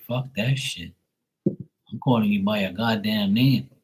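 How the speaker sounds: normal talking voice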